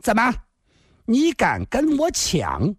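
high, slow threatening challenge; stern, fierce. A narrator's reading. Fat woman glaring at a rival for the man, fiercely. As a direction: challenge the rival sharply, drawing the words out. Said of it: "厉声地说道".

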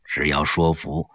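put-on elderly statesman. Li Jishen confiding to Yang Jie, low and measured. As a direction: speak like a senior statesman confiding.

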